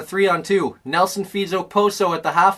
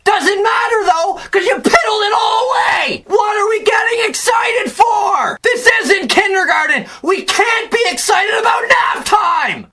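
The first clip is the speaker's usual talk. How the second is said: very high-pitched, strained rant; outraged shouting; 12 semitones higher than usual. Real, not acted. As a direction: yell at the team in sheer exasperation. Rant peaks, scolding the team.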